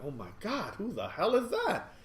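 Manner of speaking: mock alarm, leaping high at the end; retold startled outburst, pitch jumping up. Store owner sees headlights rushing at his window, startled.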